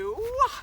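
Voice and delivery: sudden high yelp mid-jump; startled yelp. Real, not acted. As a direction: yelp as you leap. Jumping over something on the hill.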